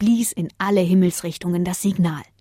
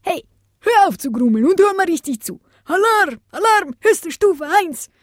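much higher, louder alarm call; 11 semitones higher, 4 dB louder than usual. Acted. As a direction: sound the alarm urgently. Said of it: "rief Antonio".

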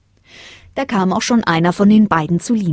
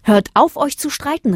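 raised-voice scolding, rising higher; calling out; 8 semitones higher than usual. A narrator's reading. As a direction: call a halt to the squabble. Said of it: "rief der Mond".

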